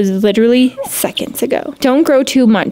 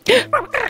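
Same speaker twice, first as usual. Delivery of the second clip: delighted gasp; 10 semitones higher, 5 dB quieter than usual. Real, not acted.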